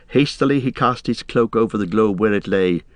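usual speaking voice

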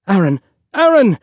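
calling sharply. A narrator's reading. Mother to her panicking husband, urgent rising call. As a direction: call to get through to someone, escalating.

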